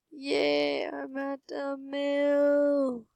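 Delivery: high, long-held celebration; drawn-out cheer. Real, not acted. Player celebrating a first million, drawn-out high cheer. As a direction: stretch the last word out high and slow.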